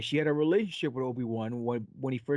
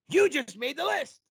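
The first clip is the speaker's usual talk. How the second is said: high, loud announcer call of the segment name; booming game show host; 12 semitones higher, 5 dB louder than usual. Acted. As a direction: sing out the title like a show jingle.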